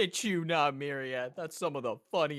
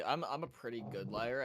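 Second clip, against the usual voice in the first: ordinary talk, nothing marked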